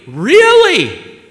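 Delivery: high, loud disbelieving really; amazed. Retold or imitated. A hungry single man hearing the meal is free, amazed. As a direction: leap up in surprise.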